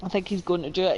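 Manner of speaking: normal talking voice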